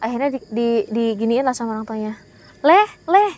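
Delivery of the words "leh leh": calling out. Retold or imitated. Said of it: "gitu kan".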